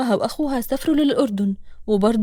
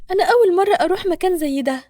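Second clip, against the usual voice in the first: Nour's voice; 6 semitones higher, 3 dB louder than usual. Acted. Nour speaks, voiced higher.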